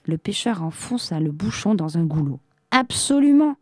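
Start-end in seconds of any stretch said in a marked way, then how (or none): 2.7-3.6 high, loud and gleeful; amused triumph. A narrator's reading. Fisherman has just corked the genie in, gleeful. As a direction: crow it as if about to laugh. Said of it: "dit-il en riant".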